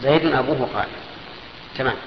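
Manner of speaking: ordinary talk, nothing marked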